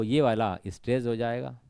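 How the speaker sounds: ordinary talk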